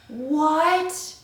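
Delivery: real stunned what; shocked what. Real, not acted. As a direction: hit it in pure disbelief. Plot twist reveal.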